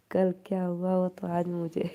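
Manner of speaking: real laughter breaking in; laughing. Real, not acted. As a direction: laugh out the line. Laughing at friend's sulking.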